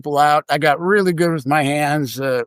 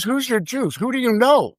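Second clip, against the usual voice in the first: higher-pitched, pressing questions; insistent questioning; 7 semitones higher than usual. Retold or imitated. Cage manager presses the puzzled clerk about connections, insistent.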